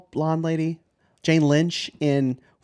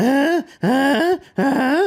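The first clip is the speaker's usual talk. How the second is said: loud, high-pitched grunts; hammed-up high grunting; mock sex noises; 12 semitones higher, 5 dB louder, much slower than usual. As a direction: imitate a man's noisy rhythmic moans. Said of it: "he's making all that noise".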